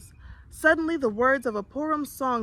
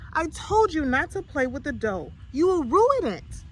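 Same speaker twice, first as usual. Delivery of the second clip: rising, louder scolding mother; 5 semitones higher, 4 dB louder than usual. A narrator's reading. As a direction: warn him off the dough, getting sharper. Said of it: "she demanded".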